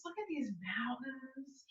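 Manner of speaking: hushed whisper of wonder. Real, not acted. Teacher pointing out the mountains to the class, in an awed whisper. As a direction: breathe it out as if in awe.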